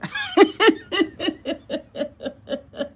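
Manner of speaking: long, high cackling run; high laughter. Real, not acted. Woman mocking where they move next year, high laughter. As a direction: burst out laughing high and keep going. Laughs at her own jab.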